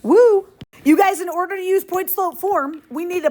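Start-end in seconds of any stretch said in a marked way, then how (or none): 0.0-0.5 excited cheer. Real, not acted. Teacher cheering the class.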